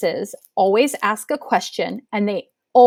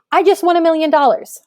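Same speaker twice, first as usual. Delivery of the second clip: higher, louder and fast, bursting with excitement; excited exclamation; 7 semitones higher, 4 dB louder, faster than usual. Shown as a demonstration. Teacher reading an exclamatory example to students, excited. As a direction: blurt it out like you just won. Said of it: "I'm super excited here".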